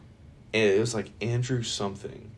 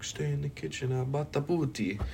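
higher-pitched hammed-up Italian accent; mock Italian accent; 6 semitones higher than usual. Imitating Italians for a food joke, mock Italian accent. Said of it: "I'm gonna go to fucking Italian".

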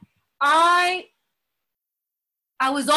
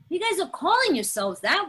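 ordinary delivery

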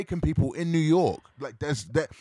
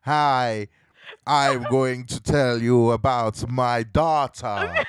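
slow, loud, over-enunciated mock Jamaican accent; bad Jamaican accent; 3 semitones lower, 4 dB louder, slower than usual. Host mocking an actor's attempt, bad Jamaican accent. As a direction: lampoon a laboured fake Jamaican accent, low and deliberate. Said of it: "try to do that Jamaican accent".

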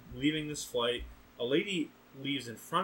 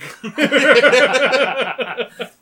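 loud burst of laughter; 12 semitones higher, 18 dB louder than usual. Real, not acted. Tony Hawk manual joke.

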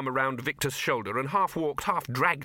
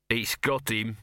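ordinary delivery, nothing marked

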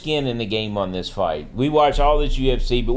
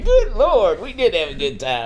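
imagined late friend; 8 semitones higher than usual. Retold or imitated. Imagining late friend looking down, fondly exclaiming. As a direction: begin high on an exclamation, then settle down. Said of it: "I could hear him".

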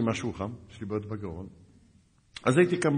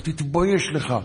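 mock angry scolding, raised voice; louder, higher imitated telling-off; boiling anger; 5 semitones higher, 5 dB louder, slower than usual. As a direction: hurl accusations like an outraged sergeant. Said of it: "הוא התעצבן, הוא התרתח".

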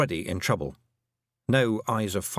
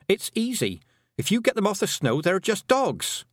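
higher-pitched character voice, matter-of-fact assurance; Tiffany, confident young heroine; 9 semitones higher than usual. Acted. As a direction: explain it eagerly in a raised pitch.

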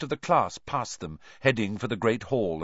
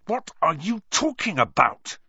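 higher, louder angry demand; 8 semitones higher, 4 dB louder than usual. Acted. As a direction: snap it out angrily. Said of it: "said Harry angrily".

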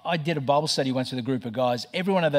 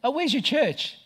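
imitated attendant, pitched way up; lovely Filipino attendant; 12 semitones higher than usual. Attendant asks the pastor about his church, high mimicked voice. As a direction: ask it in a high put-on voice as the attendant. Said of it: "I say to this lovely Filipino, uh, attendant".

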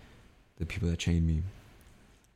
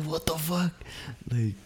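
outraged retort; 5 semitones higher than usual. Retold or imitated. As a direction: shout it up high in disbelief at the boss. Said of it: "I was like".

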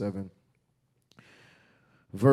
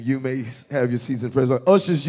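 ordinary talk

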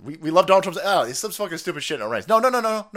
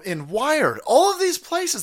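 ordinary delivery

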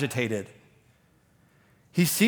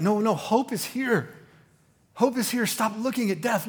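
higher, insistent, calling people to hope; Jesus pleads with mourners, higher and urgent; 7 semitones higher than usual. Retold or imitated. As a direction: urge the grieving crowd to look up.

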